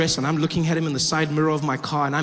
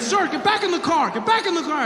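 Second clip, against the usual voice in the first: much higher, shouted cop orders; yelling cop; 10 semitones higher than usual. Retold or imitated. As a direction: bark at him to get back in.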